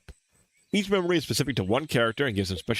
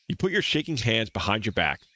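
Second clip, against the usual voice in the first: ordinary talk, nothing marked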